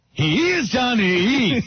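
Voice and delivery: high, slow, drawn-out Here's Johnny; exhausted Shining retake; weary retake impression. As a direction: drag it out like a tired actor. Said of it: "Take a hundred sixty-three".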